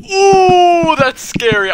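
real terror bursting out; shrieking scream as the monster turns around; frightened scream. Real, not acted. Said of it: "that was terrifying".

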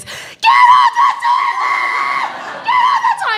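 very high, slow frantic yell; panicked shout. Retold or imitated. Mom screaming at her son to get off, frantic yell. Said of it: "The next thing that comes out of my mouth is".

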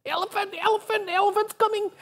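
high, quick little bee call; tiny bee voice. Retold or imitated. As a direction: squeak the news like a tiny bee. Bee warning the hive.

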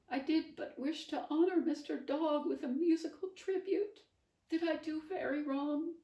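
high, loud, quavering lament; tearful Bonaparte. Acted. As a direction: plead through tears. Sobbing Bonaparte.

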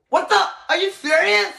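high, indignant outburst; shocked disbelief. Real, not acted. Spooked homeowner as the trouble returns, shocked disbelief.

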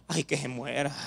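low, very soft grumble; hushed, listless brush-off; dismissive mock reply. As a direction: answer under your breath, uncaring. Acts listener's reply to feeble greeting.

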